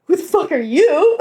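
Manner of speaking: high, mock-outraged retort; indignant comeback. Teller retells her comeback to the man, mock indignant.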